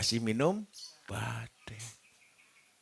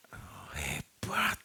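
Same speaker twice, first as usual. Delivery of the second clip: mock whisper; 10 semitones lower, 6 dB quieter, much slower than usual. Mock amazed reaction to injected meat.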